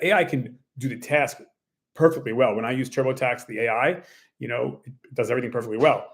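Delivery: usual speaking voice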